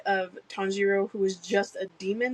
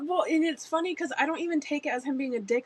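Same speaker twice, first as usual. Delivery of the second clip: usual speaking voice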